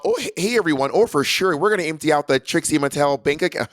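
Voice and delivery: bad Midwestern accent as Trixie. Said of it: "I'm trying to do a Midwestern accent".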